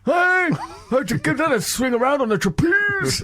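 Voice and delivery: Prince Philip impression. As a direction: bellow it like an old royal teasing an acrobat. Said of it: "and he went".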